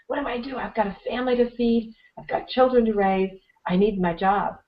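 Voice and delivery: mock-helpless seminar attendee; imitated worry. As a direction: plead your case, lightly acted.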